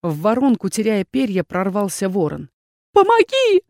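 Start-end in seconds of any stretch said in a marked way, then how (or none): 2.9-3.6 high, loud cry for help; desperate high shout; scream for help. A narrator's reading. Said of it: "закричала я".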